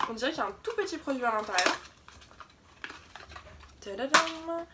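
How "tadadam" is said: excited. Real, not acted. Revealing box three.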